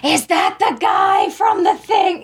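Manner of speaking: high, slow, loud movie-theatre question; parents loud at a movie. Retold or imitated. Son mocking his parents at a movie, loud slow parent voice. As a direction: blurt it out like parents who can't whisper. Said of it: "both of them going".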